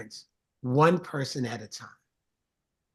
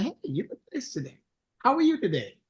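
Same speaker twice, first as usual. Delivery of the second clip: lightly voiced cheery hello, pitched up; chirpy friendly check-in, a touch higher; cheerful greeting; 7 semitones higher than usual. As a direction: greet a stranger brightly. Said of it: "To say".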